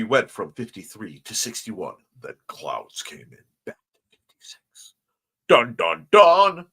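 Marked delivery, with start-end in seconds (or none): 5.5-6.7 loud sung dun dun dun; dramatic sting. Pretend.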